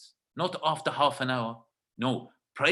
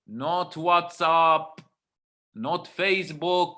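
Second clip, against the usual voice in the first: very slow, word by word; slow emphatic; much slower than usual. Real, not acted. Teacher stressing the point to listeners, very slow. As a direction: spell it out slowly for the class. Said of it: "I don't know why I'm talking like this".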